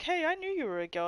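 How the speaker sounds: high, fast mock chirpy voice; high singsong mimicry; mocking people. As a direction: parody the clueless viewers brightly. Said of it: "People are like".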